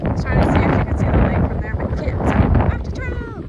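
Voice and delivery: loud, shouting over a strong wind. Real, not acted. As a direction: raise the voice so the wind can't drown it. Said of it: "you can even hear me over the wind".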